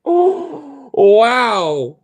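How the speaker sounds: loud, high gasp of amazement, slow on holy shit; stunned shout. Real, not acted. Streamer stunned by the stream, loud amazed cry. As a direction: let it burst out in awe.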